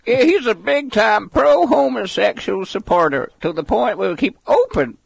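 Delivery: high, loud mock American accent, hammed up. Mocking the preacher's quote for his co-host, in a high, loud put-on American accent. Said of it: "can I say this in my American accent".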